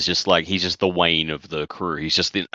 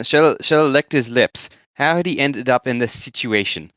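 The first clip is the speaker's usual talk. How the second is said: attempted New Zealand accent, slightly overcompensated; New Zealand accent drifting towards South African; 5 semitones higher than usual. Shown as a demonstration. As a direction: read slowly in a careful New Zealand accent. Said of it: "in a New Zealand accent".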